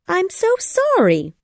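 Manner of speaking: put-on very high girl's voice, apologising; high, sympathetic storybook girl; Wendy. A narrator's reading. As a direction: apologise with the pitch lifted right up.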